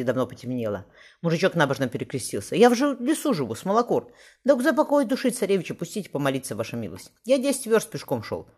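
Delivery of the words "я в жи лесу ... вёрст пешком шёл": higher, louder peasant voice, begging humbly; pleading peasant. A narrator's reading. Peasant begging the guard to let him pray, pleading. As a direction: plead with the guard like a poor woodsman. Peasant begs to enter church.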